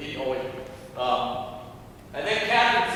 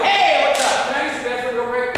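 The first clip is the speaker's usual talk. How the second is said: loud casual greeting; 7 semitones higher, 6 dB louder than usual. Retold or imitated.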